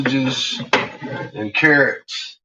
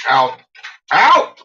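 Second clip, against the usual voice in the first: sharp shouted command; 8 dB louder than usual. Real, not acted. Ordering dog out.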